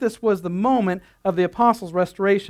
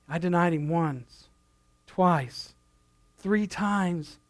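a quieter, weighed-down inner voice, imitating Peter; softly voiced guilt; 3 semitones lower, 6 dB quieter, much slower than usual. Preacher voicing Peter's guilt to the congregation, softly. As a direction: voice Peter's guilt under your breath.

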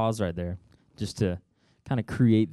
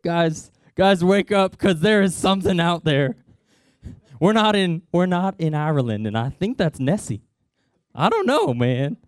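higher and louder, urgent wake-up; panicked; 6 semitones higher, 6 dB louder than usual. Acted. Disciple shaking awake his crewmates, loud and panicked. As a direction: rouse the crew in a frightened rush. Disciple waking others in fear.

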